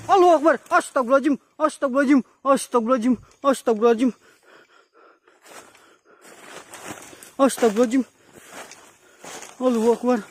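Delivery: high, panicked repeated prayers; frightened. Real, not acted. Startled by something in the dark.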